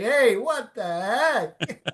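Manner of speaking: high, loud mock protest; mock disbelief. Retelling a ballplayer's reaction to losing at chess, mock disbelief. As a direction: imitate a beaten player's high-pitched outcry. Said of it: "Austin Hedges was like".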